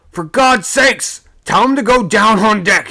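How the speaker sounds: a sailor shouting down from above; desperate shout. A narrator's reading. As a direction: shout the warning down. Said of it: "he was shouting".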